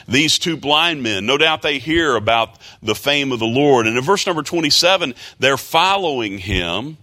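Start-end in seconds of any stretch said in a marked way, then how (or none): none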